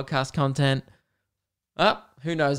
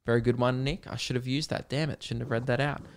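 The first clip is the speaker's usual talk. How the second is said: usual speaking voice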